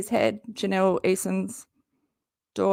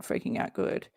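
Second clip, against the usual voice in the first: whispered; 9 dB quieter than usual. Real, not acted. Excited aside to viewers.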